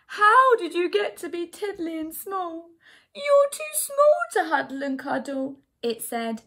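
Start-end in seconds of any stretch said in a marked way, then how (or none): 0.1-2.7 high, loud storybook wail; bawling. A narrator's reading. Bear to its tiny teddy, hammed-up bawling. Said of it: "the voice bawl". 3.1-5.6 loud, high-pitched mock wail; hammed-up upset bear voice; bawling bear. A narrator's reading. Bear to its too-small teddy, hammed-up bawling. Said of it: "the voice bawl".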